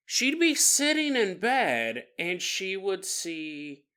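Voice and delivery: ordinary delivery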